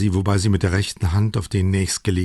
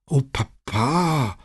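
slow, drawn-out, higher groan of protest; Chiara, groaning; 6 semitones higher, much slower than usual. A narrator's reading. Daughter groans at her father's declaration of love, exasperated. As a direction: groan it out, stretching the last syllable. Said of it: "stöhnte Chiara".

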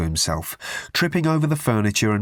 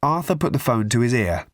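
ordinary delivery

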